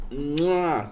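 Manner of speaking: ordinary talk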